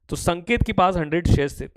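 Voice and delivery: ordinary talk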